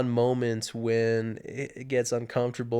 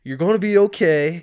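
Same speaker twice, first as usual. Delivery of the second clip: raised, firm voice over the wind, higher and louder; calling out to get attention; 6 semitones higher, 10 dB louder than usual. Retold or imitated. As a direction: call his name to snap him out of it. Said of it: "He's like".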